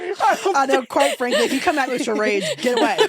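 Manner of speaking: high, loud and laughing. Real, not acted. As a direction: shout it out through laughter. Laughing, loud playful scolding.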